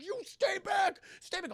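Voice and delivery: high-pitched frantic acted shout; panicked warning. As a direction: ward off attackers at the top of your voice. Hostage taker warding off killers.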